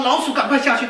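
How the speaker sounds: a comic panicked yelp to get down now; panicked cry. Acted. Pilot panicking to the mechanic about the rat, laughing alarmed shout.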